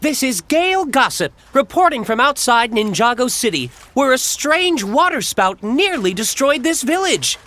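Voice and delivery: high, bright news-reporter delivery. Acted. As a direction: announce it like a TV newscaster.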